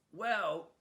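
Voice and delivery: a little dog from Brooklyn complaining; Brooklyn Chihuahua. Acted. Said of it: "a Chihuahua from Brooklyn".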